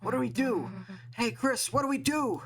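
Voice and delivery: high frantic pleading; scared, rushing the question; panicked. Acted. Panicked character asking for help.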